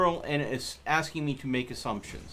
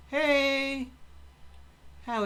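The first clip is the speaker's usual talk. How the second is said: high, bright hello to the animals; cheery greeting call; 11 semitones higher than usual. Real, not acted. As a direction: call out happily to the pen.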